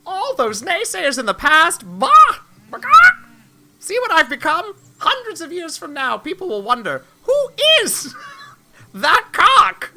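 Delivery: grandiose rooster, high and loud. Acted. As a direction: declaim like a self-important squawking rooster. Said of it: "I'm gonna do this rooster voice".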